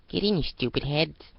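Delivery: high bossy bratty call; bossy yell. Acted. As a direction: order them in like a brat. Adagio yells from car.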